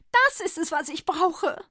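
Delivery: high and loud; delighted Santa finds his cure; jubilant. A narrator's reading. Santa to the druggist, jubilant and high. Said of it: "frohlockte der Weihnachtsmann".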